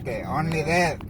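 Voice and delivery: loud playful punchline; loud teasing. Real, not acted. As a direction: deliver the joke with glee. Teasing son-in-law.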